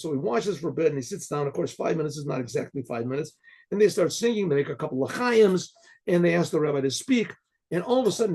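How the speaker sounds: normal talking voice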